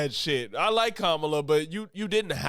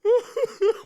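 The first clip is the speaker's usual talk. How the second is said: loud high burst of laughter; 5 dB louder than usual. Real, not acted. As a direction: crack up high. Cracking up at the list.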